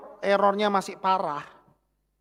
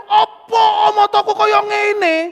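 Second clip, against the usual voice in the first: loud, high, drawn-out lament; wailed complaint, slow and very high; hammed-up tearful wail; 15 dB louder, slower than usual. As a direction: imitate crying out in despair, at full volume. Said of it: "Aku nangis".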